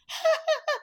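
imitated high, affected laugh; Kim. Friend showing how Kim laughed, high mocking laugh. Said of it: "That's how she laughed".

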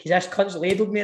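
normal talking voice

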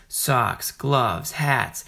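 normal talking voice

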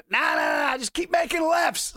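high, loud retold shout; sick passenger yelling to the driver to keep going; shouting out the window. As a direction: yell it over the wind, head out the van. Yelling from window of speeding minivan.

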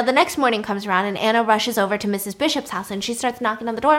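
usual speaking voice

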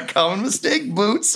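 high-pitched crewmates' chorus; teasing crew. Acted. Ghost crew correcting Boots, teasing. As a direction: chime in high, ribbing a crewmate. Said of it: "And they're all like".

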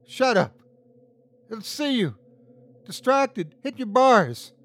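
high, tense urgent commands; urgent high orders. A narrator's reading. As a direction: hurry them before it sees. Urgent orders against the monster.

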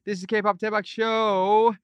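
big booming title read; hammed-up announcer. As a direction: belt the show name like an announcer. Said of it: "I sound ridiculous".

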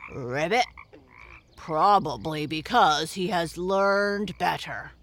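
low, gruff and loud frog; gruff old frog voice; deep gruff frog. Acted. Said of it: "in his deepest, gruffest voice".

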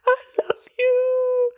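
very high, squeaky, teary cry; mock sobbing adoration. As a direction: gush to the nurse in a squeaky fake sob. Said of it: "I was like".

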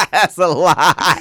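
loud, slow, high laughing shout; laughing accusation. Real, not acted. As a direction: draw it out through big laughter. Teasing challenge repeated through laughter.

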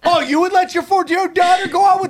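high, fast indignant challenge; rapid-fire playful outrage; mock outrage. Mock challenge to co-host's scolding.

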